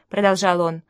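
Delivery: ordinary delivery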